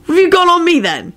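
high, lively question; cheerful. A narrator's reading. George asking Shacklebolt about his file, cheerful. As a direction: ask it brightly, pitched well up. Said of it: "he asked Shacklebolt cheerfully".

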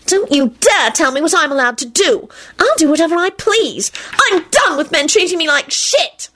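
high, indignant tirade; defiant, high-pitched fury; furious outburst. A narrator's reading. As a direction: rage at him, pitch soaring. Woman's furious outburst in quarrel.

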